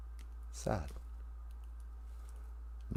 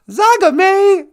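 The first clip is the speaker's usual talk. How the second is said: much louder and much higher call of the name; excited greeting; 11 dB louder than usual. Real, not acted. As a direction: call Zagame out with delight.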